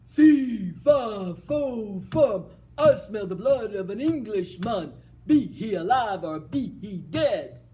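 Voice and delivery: loud, thundering giant voice; booming giant. Acted. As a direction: boom it out like a hungry giant. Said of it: "And he says".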